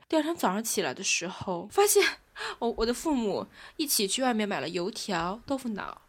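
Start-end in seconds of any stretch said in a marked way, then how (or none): none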